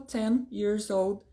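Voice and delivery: ten said slowly and clearly; slow clear teacher model, ten stretched; slow model phrase. Shown as a demonstration. Teacher models the age phrase slowly. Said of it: "por ejemplo".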